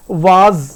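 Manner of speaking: वाज with a long "aa", like "vaaz"; loud, low, stretched वाज; wrong pronunciation. Shown as a demonstration. Teacher showing a common mistake, mispronounced. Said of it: "तो ये भी गलत".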